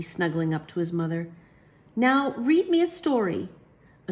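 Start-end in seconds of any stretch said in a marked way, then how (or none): 1.9-3.5 put-on child voice. Child demanding story.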